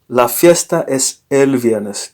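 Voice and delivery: «la fiesta es el viernes» said slowly and clearly, with «el» included; slow correct model. Shown as a demonstration. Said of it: "Don't say just la fiesta es viernes".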